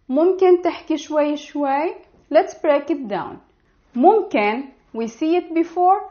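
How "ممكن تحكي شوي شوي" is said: fast natural repeat. Shown as a demonstration. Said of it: "you will say".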